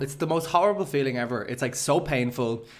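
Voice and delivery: ordinary talk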